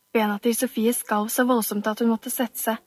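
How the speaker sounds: ordinary delivery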